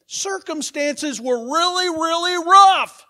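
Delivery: building to a loud, high, slow climax; dramatic storytelling. Shown as a demonstration. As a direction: stretch it out and blow up on really rough. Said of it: "the pastor can be very dramatic".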